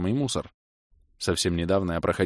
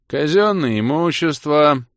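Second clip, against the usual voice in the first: melancholy reply; 8 semitones higher, 7 dB louder, slower than usual. A narrator's reading. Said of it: "меланхолично отозвался".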